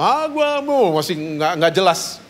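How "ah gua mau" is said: put-on high babbling little-girl voice; small girl's babble. Pastor imitating his six-year-old daughter's babble, high and childlike. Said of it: "ngomongnya masih bahasa planet loh".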